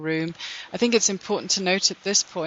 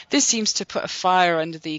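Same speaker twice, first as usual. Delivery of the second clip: normal talking voice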